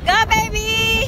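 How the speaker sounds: high, slow, drawn-out cheer; excited cheer. Real, not acted. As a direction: cheer them on loudly. Cheering someone jumping in.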